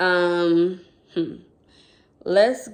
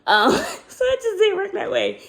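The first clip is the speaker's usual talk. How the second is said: laughing hard, much higher; 14 semitones higher, 3 dB louder than usual. Real, not acted. Explaining to viewers money was short, laughing. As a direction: giggle through the words.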